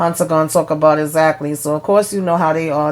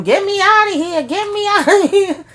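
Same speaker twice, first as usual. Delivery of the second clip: mock screaming; 14 semitones higher than usual. Recapper imitates Cal demanding out, high mock scream.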